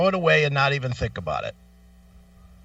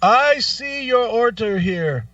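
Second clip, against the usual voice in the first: mock phone agent; 6 semitones higher, 3 dB louder than usual. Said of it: "He goes".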